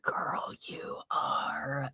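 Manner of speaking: breathy aside to the defendant, no voice; conspiratorial whisper. Real, not acted. Warning the defendant in an aside, a voiceless whisper. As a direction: lean in and whisper it like gossip.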